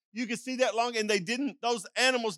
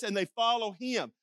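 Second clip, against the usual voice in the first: normal talking voice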